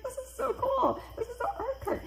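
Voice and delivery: giddy, very high-pitched gushing; thrilled squeal of enthusiasm; retold excitement. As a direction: gush like you're running toward a treasure. Running eagerly to art cart.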